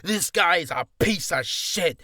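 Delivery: loud, slow mock tirade; blasting rant. Angry actor publicly blasts someone online, mock loud rant. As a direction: blast it out, stretching the words. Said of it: "Don't need to Twitter blast them or Instagram blast them like".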